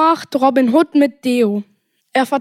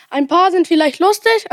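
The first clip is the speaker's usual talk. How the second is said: ordinary delivery, nothing marked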